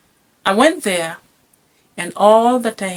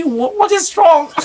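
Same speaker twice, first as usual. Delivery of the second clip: high, loud astonished question; 10 semitones higher, 6 dB louder than usual. Retold or imitated. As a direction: sound amazed at the change. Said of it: "I was like".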